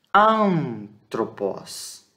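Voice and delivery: ANTROPOS with a long-held nasal first syllable; slow pronunciation demo. Shown as a demonstration. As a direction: stretch the AN of Antropos through the nose, then tropos. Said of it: "ele tem um som nasal".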